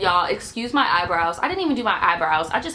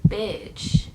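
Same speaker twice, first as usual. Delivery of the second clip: muttered thought; 4 dB quieter than usual. Retold or imitated. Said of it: "I'm just thinking, like".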